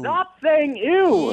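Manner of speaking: high, slow, drawn-out exasperated yell. Real, not acted. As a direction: stretch it out, totally fed up. Trainer fed up with caller.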